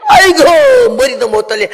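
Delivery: high, loud anguished wail; loud grieving 아이고; wailing cry. Pretend. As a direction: cry out like a mother who lost her child. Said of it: "나 같으면 이래야지".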